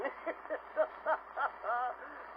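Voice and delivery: string of short staccato chuckles; a storyteller's punctuating laugh; comic chuckle. Acted. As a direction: chortle at your own joke. Laughs at his own joke.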